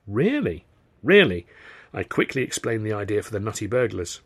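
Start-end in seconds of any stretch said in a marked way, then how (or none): 0.1-0.7 surprised question. A narrator's reading. Surprised question, then his confirming echo. 1.0-1.5 loud, firm falling answer; emphatic confirmation. A narrator's reading. Emphatic answer to her surprised question.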